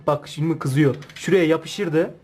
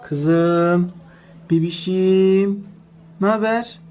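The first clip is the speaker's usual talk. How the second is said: pet talk; 2 semitones higher, much slower than usual. Real, not acted. Greeting the pet dog.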